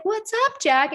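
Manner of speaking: put-on high, light greeting to a kid; school owner greeting child. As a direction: imitate a teacher checking on a small child.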